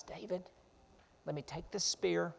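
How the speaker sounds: soft, secretive aside; hushed urging. Acted. Abishai to David over sleeping Saul, hushed. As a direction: keep it down beside a sleeping enemy.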